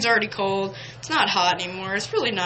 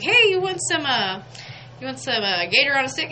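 much higher and louder, bright offer; cheery offer; 6 semitones higher, 4 dB louder than usual. Retold or imitated. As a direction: offer the snack with a big smile. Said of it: "I was like".

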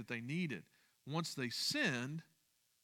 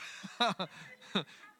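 breathless laugh; 4 semitones higher than usual. Real, not acted. Laughs at husband-wife moment.

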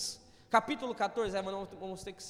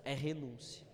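low soft solemn close; hushed emphasis; 8 semitones lower, 6 dB quieter than usual. Real, not acted. Pastor driving the point home, low hush. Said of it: "Entenda isso".

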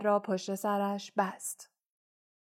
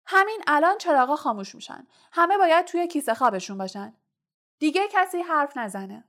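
higher, louder, fast commanding shout; shouting order; 6 semitones higher, 4 dB louder than usual. A narrator's reading. Percy ordering lights out to everyone, shouting. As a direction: yell it across the hall. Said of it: "پرسی فریاد زد".

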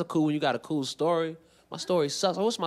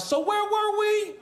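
high, drawn-out sing-song question; mock smooth come-on; slower than usual. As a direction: stretch it out high and slow, playfully. Mock smooth return to flirting.

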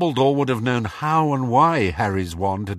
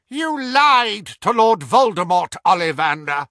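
higher, louder cold fury; furious accusation; 11 semitones higher, 7 dB louder than usual. A narrator's reading. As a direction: accuse him with menace. Voldemort accusing Ollivander.

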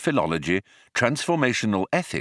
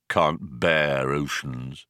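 slow, low weary robot drone; lowered sluggish machine voice; gloomy robot; 6 semitones lower, much slower than usual. Acted. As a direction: drag it out in bored disgust. Marvin sneers at oceans.

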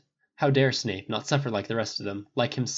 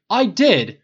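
high, emphatic protest; louder, defensive repeat; insisting; 10 semitones higher, 12 dB louder than usual. A narrator's reading. Harry protesting to Snape, insistent. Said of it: "insisted Harry".

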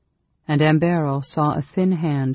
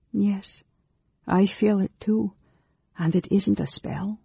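lightly higher, kind woman's voice; gentle young woman; 6 semitones higher than usual. A narrator's reading. Amberol's voice.

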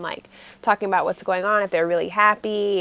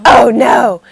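loud alarmed oh no; 13 dB louder than usual. Retold or imitated. Tae hearing his dad smash things, loud alarm. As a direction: shout it in shock. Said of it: "he's just like".

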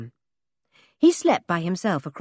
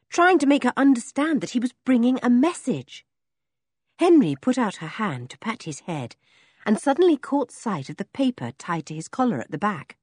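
plain reading voice

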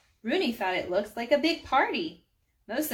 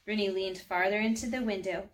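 ordinary delivery

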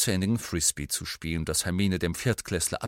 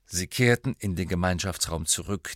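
ordinary delivery

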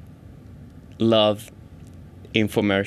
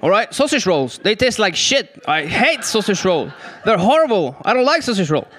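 higher, whiny complaining self; mock whiny complainer; 7 semitones higher than usual. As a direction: moan about the food like a fussy tourist. Acted chat with Australian mentor.

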